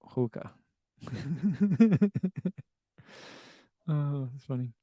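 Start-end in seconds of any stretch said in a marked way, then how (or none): 0.0-0.5 hookah said as "HOO-kuh"; Boston pronunciation. Retold or imitated. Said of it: "hear Boston people say". 1.0-2.6 high, bubbling giggles; laughing. Real, not acted. Laughing at the Boston joke.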